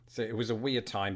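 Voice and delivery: ordinary delivery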